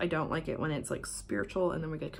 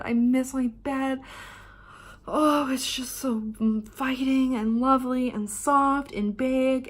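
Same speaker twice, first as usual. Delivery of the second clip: slow, higher, dreamy gush; longing; 5 semitones higher, 4 dB louder, slower than usual. Real, not acted. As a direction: stretch it out, aching for home. Gushing, drawn out about her bed.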